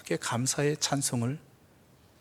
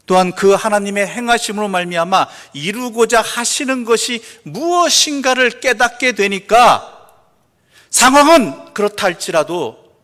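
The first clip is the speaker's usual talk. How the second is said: loud, raised, emphatic declaration; full-force preaching; 8 semitones higher, 14 dB louder than usual. Real, not acted. Preacher declaring God's purpose despite circumstances, full-force preaching. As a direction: drive the point home from the pulpit.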